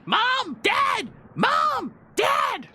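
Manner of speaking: very high squealing little boar; high-pitched, drawn-out panicked cries; screaming boar. Acted. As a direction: scream for your parents in a squeaky animal voice. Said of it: "It's still screaming".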